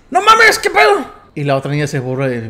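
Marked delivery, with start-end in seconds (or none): 0.1-1.1 high, loud panicked shout; a girl shrieking in sudden fright; scared shout. Retold or imitated. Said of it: "Y la niña dice".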